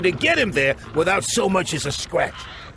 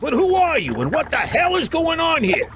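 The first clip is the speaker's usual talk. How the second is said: much higher, rattled demands; agitated; 8 semitones higher than usual. Acted. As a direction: demand answers, still shaken. Vinnie just escaped zombies.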